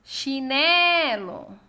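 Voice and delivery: "Chinelo" called loudly, NE stretched slowly; calling out the word. Shown as a demonstration. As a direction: call out "Chinelo", dragging out the NE. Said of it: "esse exercício de chamar as palavras".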